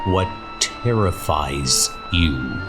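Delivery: normal talking voice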